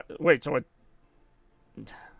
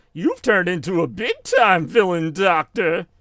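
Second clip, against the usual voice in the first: mocking taunt, higher and drawn out; Sonic; 3 semitones higher, 4 dB louder, slower than usual. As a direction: taunt the villain like a cartoon hero. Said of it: "he's, like, mocking him".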